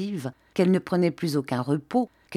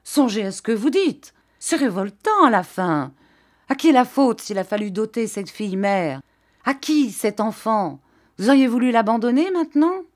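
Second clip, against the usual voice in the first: loud, higher outburst, faster at the end; outraged baron shouting at his son-in-law; 5 semitones higher, 4 dB louder than usual. A narrator's reading. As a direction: explode at him, stamping.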